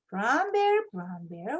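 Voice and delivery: ordinary delivery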